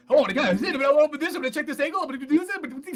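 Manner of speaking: high, loud, frantic gabble; mock a high-strung cat in a racing voice; neurotic cat. Co-host mimicking the cat voiceover, neurotic and frantic. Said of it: "that is a neurotic cat".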